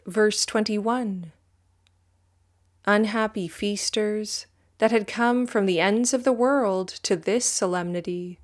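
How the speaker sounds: usual speaking voice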